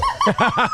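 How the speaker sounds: high squeaky imitation of the laugh; imitated laugh. Friend imitating the host's new laugh, high squeak. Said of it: "Did you hear it?".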